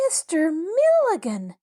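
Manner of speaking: high, shocked exclamation; astonished gasp. A narrator's reading. Astonished girls hear who the tenant is, gasping. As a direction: exclaim the name in high-pitched disbelief. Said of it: "gasped three of the astonished girls".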